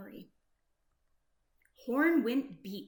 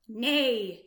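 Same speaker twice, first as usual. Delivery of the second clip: louder mock neigh; horse neigh; 7 dB louder than usual. Horse's call in story.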